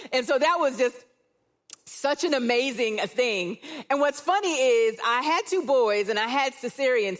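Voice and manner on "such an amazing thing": usual speaking voice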